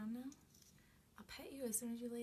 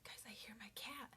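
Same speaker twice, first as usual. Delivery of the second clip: whisper; 6 dB quieter than usual. Real, not acted. Hears cat, whispers.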